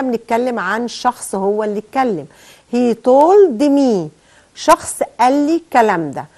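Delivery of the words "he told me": very slow and clear, told stressed; slow dictation. Shown as a demonstration. Teacher confirming the student's answer slowly. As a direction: dictate each word to the class. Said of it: "أنا سامع حد بيقول لي".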